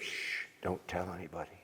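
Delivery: a soft, hushed shh, passing on a secret. Retold or imitated. The captain confiding his Vietnam past, hushed. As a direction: lean in and hush it like a shared secret.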